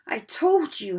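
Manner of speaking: breathless emphatic; gasped out. Acted. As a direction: push it out between breaths. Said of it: "Hermione gasped".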